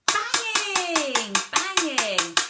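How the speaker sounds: loud, high, stretched-out "banging"; "banging" boomed out for a child to copy; emphasis on banging. Shown as a demonstration. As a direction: call out "banging" big and slow, falling from high. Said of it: "You could emphasize the word".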